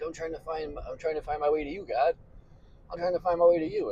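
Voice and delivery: high, fast, frantic pleading to God. Retold or imitated. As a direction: beg God breathlessly, in a rush. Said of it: "trying to be like".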